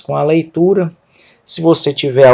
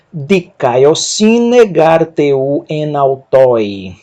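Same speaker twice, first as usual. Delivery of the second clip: each syllable drawn out very slowly; slow Greek demo; much slower than usual. Shown as a demonstration. Teacher models Greek for students, very slow. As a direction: spell the word out slowly for learners. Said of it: "Vou ler o texto".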